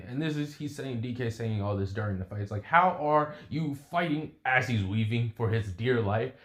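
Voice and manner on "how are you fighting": higher, slow, drawn-out question; incredulous. Acted. Fighter questions his opponent while dodging, incredulous. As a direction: stretch the question in disbelief.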